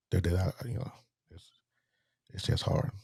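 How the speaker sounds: choked up. Real, not acted. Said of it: "I'm not gonna get choked up here".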